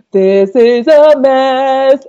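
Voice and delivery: high, loud, drawn-out inner wail; mock dismay. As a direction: stretch it out in hammed-up panic. Retold panicked realization in lecture.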